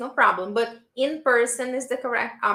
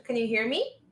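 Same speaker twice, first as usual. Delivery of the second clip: "Can you hear me" with the voice rising on "me"; rising question intonation; 4 dB quieter, faster than usual. Shown as a demonstration. Teacher models the correct way to ask, rising intonation. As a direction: keep "can" weak and lift "me". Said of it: "the correct way to ask this question".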